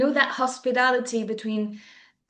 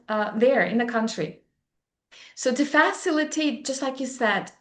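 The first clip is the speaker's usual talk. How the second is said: normal talking voice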